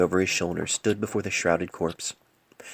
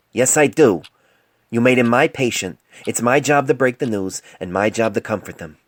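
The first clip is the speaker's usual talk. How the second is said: louder, determined reply; firm insistence; 4 semitones higher, 8 dB louder than usual. Acted. Young doctor insisting he will break the news, loud and firm. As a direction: plant your feet and insist. Painless insists on telling family.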